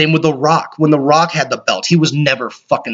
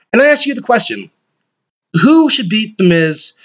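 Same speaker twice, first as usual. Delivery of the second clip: dramatic host question; 6 semitones higher than usual. Acted. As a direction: pose it like a big show host.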